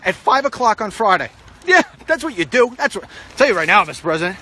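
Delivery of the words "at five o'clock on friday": a hint of a breezy press secretary; mock announcement. Advising the President to announce late briefings, mock-cheery spokesman. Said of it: "You know what you say?".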